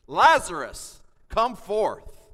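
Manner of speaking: full-voiced, high, loud call of command; commanding shout. Shown as a demonstration. Pastor voicing Jesus calling Lazarus from the tomb, full shout. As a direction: shout it like waking the dead. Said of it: "The word cry means a shout".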